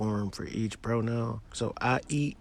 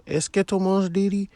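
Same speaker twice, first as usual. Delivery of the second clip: Est ce que tu manges didi? lifted high and louder as a question; rising question; 10 semitones higher, 7 dB louder than usual. Shown as a demonstration. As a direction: pitch the whole question up, rising at the end. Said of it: "raise your intonation with this".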